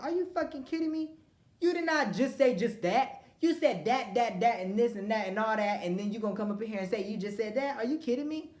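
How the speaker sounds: high, loud, disbelieving; indignant outrage. Retold or imitated. In the dean's office to a classmate, loud and outraged. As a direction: call out the liar in disbelief. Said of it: "And I'm like".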